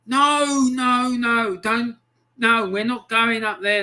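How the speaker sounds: high, insistent string of protests; urgent refusal. Retold or imitated. Warning mates off going up there, urgent refusal.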